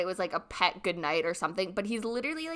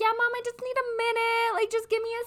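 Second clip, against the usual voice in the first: high, whiny son voice, much higher; imitating the boyfriend; 13 semitones higher than usual. Son stalling his mom through the door, in a high whiny voice. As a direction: whine back at mom to stall. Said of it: "And he's like".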